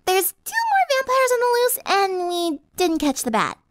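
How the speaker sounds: put-on very high, chirpy girlish voice; chiming in. A narrator's reading. Pinky breaking bad news to the group, chirpy. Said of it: "Pinky chimed in".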